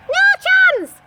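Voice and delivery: high loud shouted refusal; loud high chorus of no; pigs shouting. Acted. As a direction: shout it together. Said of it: "chorused the pigs".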